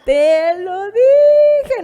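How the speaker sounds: sing-song told-you-so; drawn-out I-told-you-so. Retold or imitated. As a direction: rub it in, dragging every syllable. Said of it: "como luego te dicen".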